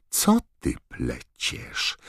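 very slow, breath-heavy hiss; hissing Snape. A narrator's reading. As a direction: draw out each word with contempt. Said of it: "syknął Snape". (bardzo powolny, pogardliwy syk)

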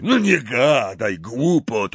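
slow, offended brush-off; indignant. A narrator's reading. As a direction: take offence at the nonsense. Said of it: "obruszył się Hagrid". (Hagrid obruszony, powoli)

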